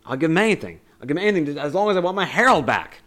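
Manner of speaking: loud, high pleading outburst; upset wife. Retold or imitated. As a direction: act out a desperate wife begging for her husband. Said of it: "she's so upset".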